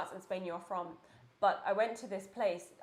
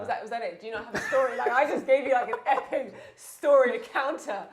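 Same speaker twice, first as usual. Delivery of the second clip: laughing; 9 dB louder than usual. Real, not acted. Friend teasing, laughing.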